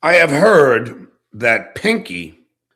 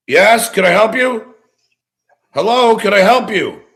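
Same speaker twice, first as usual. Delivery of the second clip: loud, raised-pitch call toward the door; 7 semitones higher, 7 dB louder than usual. Real, not acted. Streamer to someone at his front door, calling out. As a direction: call out loudly to whoever is there.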